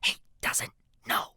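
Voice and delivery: unvoiced, whispered mutter; muttering under breath. A narrator's reading. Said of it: "I mutter under my breath".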